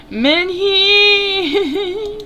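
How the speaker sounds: delighted fangirl cry; excited squeal. Real, not acted. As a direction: gush out the name like a fan. Player squeals at a crush.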